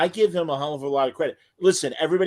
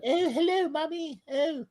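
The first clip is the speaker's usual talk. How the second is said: very high put-on English child voice; very high mock English accent, fading softer; posh English accent; 4 dB quieter than usual. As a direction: squeak it like a prim British girl. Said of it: "English accent".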